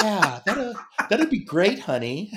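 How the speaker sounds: high wife impression again; light falsetto wife voice; man doing a woman's voice. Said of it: "I love the impression of your wife".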